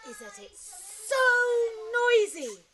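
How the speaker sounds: exasperated mum. Acted. Mum complaining about town.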